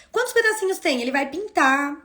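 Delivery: ordinary delivery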